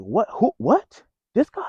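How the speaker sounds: a rising, stunned string of questions, pitch climbing; disbelief. Retold or imitated. Recalling hearing his coworker did it, stunned rising disbelief. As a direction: replay the moment you couldn't believe it was him.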